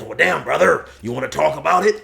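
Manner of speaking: Polar Bear. Acted. Buddy asking Josh to open up, lightly voiced bro. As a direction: offer an ear, bro to bro. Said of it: "And then Polar Bear says".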